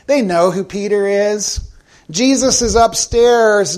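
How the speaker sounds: ordinary talk, nothing marked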